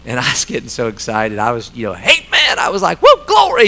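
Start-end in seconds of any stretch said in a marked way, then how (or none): 1.9-3.7 high, loud joyful whoop; retold church shout. Dad beside his son in church, whooping high and loud. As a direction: relive shouting for joy in the pew. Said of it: "and I was shouting".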